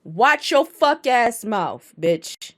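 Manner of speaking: loud, slowed menacing threat; mock threat. Co-host answers a correction with a loud mock threat. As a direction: bark it like a heated wrestler. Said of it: "He also said".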